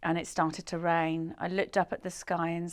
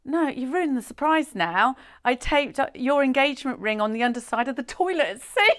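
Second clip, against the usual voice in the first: higher-pitched retold complaint; acted exasperation; 6 semitones higher, 4 dB louder than usual. Boyfriend revealing the taped-up ring, mock exasperated. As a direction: imitate an exasperated boyfriend spelling it out.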